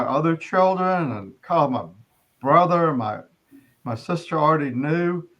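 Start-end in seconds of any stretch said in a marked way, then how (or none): none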